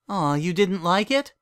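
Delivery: a hint of a sweet older mare; gentle sitter. A narrator's reading. Cadance asks the filly if she liked it, gently.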